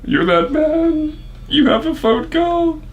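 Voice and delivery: high, slow, booming announcer bellow; fighting game announcer. Shown as a demonstration. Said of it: "my announcer voice".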